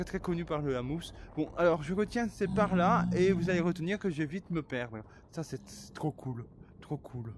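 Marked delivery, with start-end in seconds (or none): none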